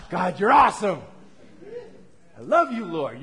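loud, glad cry of praise to God; joyful praise. Pretend. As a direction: burst out thanking the Lord. Acting out a believer's joyful prayer.

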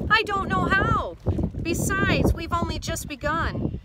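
high, loud calling voice; excited yell. A narrator's reading. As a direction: shout back from the helm, easing slightly. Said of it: "yells Duck".

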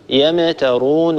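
يمترون slowly, the silent m released with a bounce; يمترون said with a qalqala echo on m; echoed meem. Shown as a demonstration. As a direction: pop the m in يمترون open. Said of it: "نقلقل حرف الميم".

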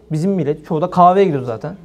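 ordinary talk, nothing marked

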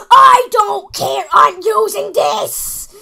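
loud, slow, determined shout; defiant shout. Real, not acted. Gamer shouting defiantly at his opponent. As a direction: slam the decision down.

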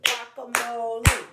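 GUACAMOLE loud, beaten out syllable by syllable; clapped syllables. Shown as a demonstration. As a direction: punch each syllable of Guacamole. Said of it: "Clap it".

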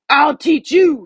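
high, loud furious howl. Acted. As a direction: howl a threat at the rabbit. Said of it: "he howled".

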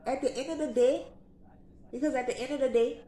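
a light [name] impression, lifting each line. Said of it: "in my [name] voice".

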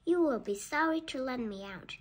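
usual speaking voice

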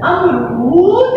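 अमरूद drawn out with a rise; अमरूद, slow rising; slow syllables. Shown as a demonstration. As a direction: stretch अमरूद slowly, rising at the end. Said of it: "teacher demo".

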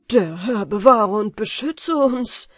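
shocked outburst; astonished cry. A narrator's reading. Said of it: "rief Jack erstaunt aus".

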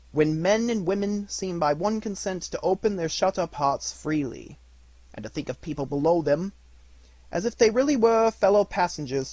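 put-on cheerful young relative's fervent defence; earnest good-hearted nephew. A narrator's reading. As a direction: make an impassioned case for Christmas. Nephew's earnest speech in story.

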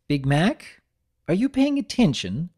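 a touch of stern nurse. A narrator's reading. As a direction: snap him back to attention. Nurse scolds.

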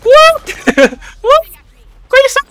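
high, loud stunned outcry; shocked disbelief. Real, not acted. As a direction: cry out in total disbelief. Shocked in-game surprise. (kaget tak percaya)

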